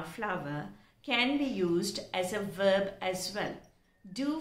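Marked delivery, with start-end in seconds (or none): none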